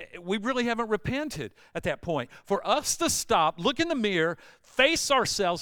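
loud, high sermon build; preaching at full force. Acted. Pastor pressing the congregation to repentance, loud. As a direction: press the point home.

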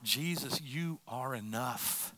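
very soft, low prayer; slow, quiet declaration of surrender; hushed cry to Jesus. Acted. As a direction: drop right down into an intimate acted prayer. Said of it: "But we cry out".

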